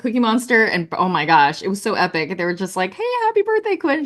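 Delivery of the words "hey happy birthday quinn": cheery high Muppet voice. Retold or imitated. Muppet characters greeting daughter.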